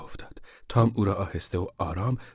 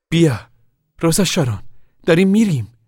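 loud, high-pitched summons; mother hollering for her daughter; calling out; 10 semitones higher, 8 dB louder than usual. Acted. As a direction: call her over to leave. Mother calls daughter to leave.

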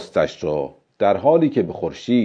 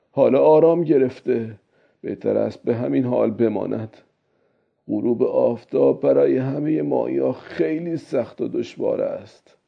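put-on musing old man, pitched up then settling; old fisherman; 5 semitones higher than usual. Fisherman deciding to leave the fish undisturbed, old-man voice. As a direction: talk to yourself as the old fisherman.

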